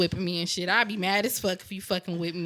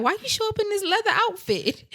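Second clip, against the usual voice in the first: put-on incredulous boyfriend; 13 semitones higher, 4 dB louder than usual. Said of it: "He'd be like".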